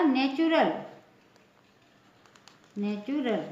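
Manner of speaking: "नेचुरल" with TU said as chu; "नेचुरल" modelled with a clear ch. Shown as a demonstration. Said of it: "इसका pronunciation क्या होगा".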